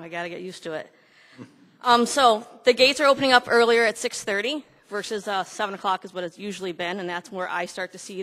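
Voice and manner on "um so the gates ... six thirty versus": loud, projecting to the room; teacher voice. Real, not acted. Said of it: "Using my teacher voice instead".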